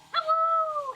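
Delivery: high, loud sing-song greeting; loud hello. Real, not acted. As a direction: call out hello to the chat. Streamer greets viewers loudly.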